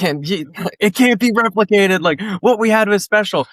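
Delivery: hammed-up cranky veteran; old man yells at cloud. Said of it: "old man yells at cloud".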